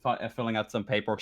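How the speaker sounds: ordinary talk, nothing marked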